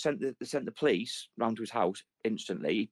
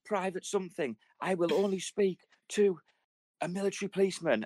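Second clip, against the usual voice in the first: pompous veteran; 8 semitones higher than usual. Retold or imitated. Retelling a veteran in the station, stiff pompous soldier voice. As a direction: insist like a captured soldier.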